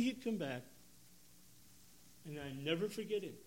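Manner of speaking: normal talking voice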